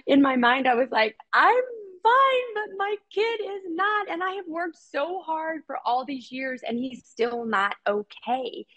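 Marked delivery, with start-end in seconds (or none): 1.3-8.7 high, tearful voice breaking through sobs; crying. Retold or imitated. Mother crying to the psychologist on the phone, tearful and high. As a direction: choke the words out through tears. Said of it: "And I was crying".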